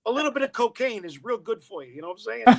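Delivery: high, loud street Brooklyn translation; Brooklyn accent. Shown as a demonstration. Translating Shakespearean for the audience, broad loud Brooklyn accent. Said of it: "it means".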